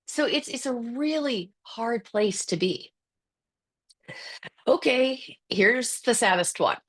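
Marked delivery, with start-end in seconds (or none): none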